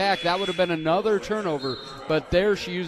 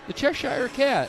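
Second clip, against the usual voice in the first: much higher-pitched clarification; pitched up to be understood; emphatic repeat; 8 semitones higher than usual. Real, not acted. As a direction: repeat it high for someone who misheard. Repeating it after being misheard.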